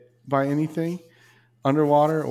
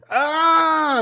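mock scream; 6 dB louder than usual. Imagined diver screaming on video.

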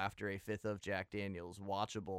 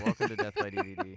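announcer voice; 9 dB louder, faster than usual. Acted. Hammy show intro.

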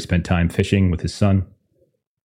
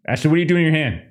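raised higher in mock alarm; mock alarmed question; 9 semitones higher, faster than usual. Real, not acted. GM calling out player for her hand. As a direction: call her out in playful alarm.

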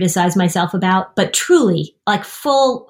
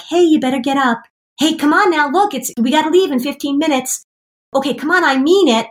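a higher, lightly voiced nice nagging; nicely nagging parent; 7 semitones higher than usual. Parent nicely nagging a kid in bed, mock patient. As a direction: coax a sleepy kid out of bed, gently. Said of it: "you come in nicely and say".

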